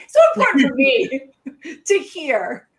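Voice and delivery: loud, amused emphasis with a laugh. Real, not acted. Jokes while correcting herself to me.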